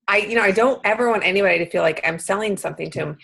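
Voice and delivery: usual speaking voice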